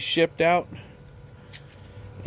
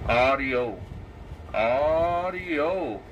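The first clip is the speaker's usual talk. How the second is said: through a CB radio speaker; CB radio sound; much slower than usual. Shown as a demonstration. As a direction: talk into the radio mic. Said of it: "that's the straight radio".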